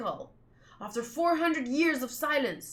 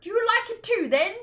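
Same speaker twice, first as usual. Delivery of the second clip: put-on high, louder British accent; 11 semitones higher, 6 dB louder than usual. A player redoing the bell question in a high British accent. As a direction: hit the line in a British lilt, rising then. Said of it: "supposed to talk British".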